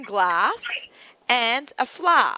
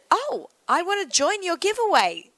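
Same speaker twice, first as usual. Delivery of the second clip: eager quote; 9 semitones higher than usual. Retold or imitated. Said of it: "and he said".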